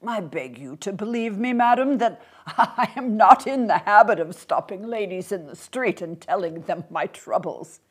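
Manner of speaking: put-on polite old man with a small laugh; courteous little old gentleman. Old man assures a suspicious lady, courteous and mildly flustered. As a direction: protest courteously, chuckling a little.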